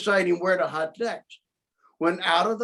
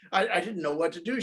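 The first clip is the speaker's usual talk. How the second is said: plain reading voice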